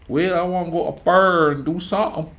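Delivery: loud, high mock hillbilly twang; hillbilly accent. Shown as a demonstration. Grenadian man giving the local hillbilly accent a shot. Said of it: "the hillbilly accent around here".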